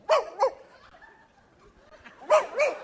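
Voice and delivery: high-pitched dog barks; Lassie. Acted. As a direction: yelp twice like an excited dog. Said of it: "Lassie who would go".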